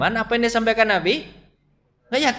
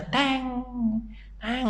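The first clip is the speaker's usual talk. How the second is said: clang sound; 4 semitones higher, 8 dB quieter than usual. Shown as a demonstration. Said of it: "Bapak tangkis ya".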